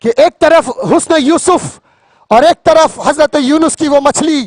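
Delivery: normal talking voice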